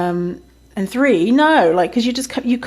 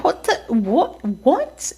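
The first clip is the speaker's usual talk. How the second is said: high, slow, baffled what; drawn-out high-pitched "what the, what, what?"; reacting to an absurd offer in shock; stunned disbelief; 7 semitones higher, much slower than usual. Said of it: "I was like".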